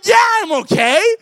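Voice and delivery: high, strained mock-cheerful "I'm okay"; fake okay. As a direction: squeak it out, pretending to be fine. Crushed but claiming to be fine.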